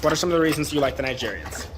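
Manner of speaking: ordinary talk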